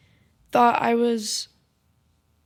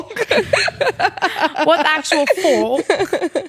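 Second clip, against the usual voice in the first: slow, drawn-out mock British outrage; British accent; much slower than usual. Swearing in the British bit, slow mock British outrage. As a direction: stretch it out like an outraged Brit. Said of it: "really loud British".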